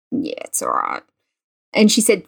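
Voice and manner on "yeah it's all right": low, playing it cool, understated; mock-reluctant admission, much lower; grudging teen shrug. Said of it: "And I said".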